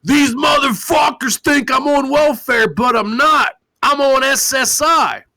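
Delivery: high, shouted mock whine; mocking impression of him. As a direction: squeal it like an indignant slob. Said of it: "He's like".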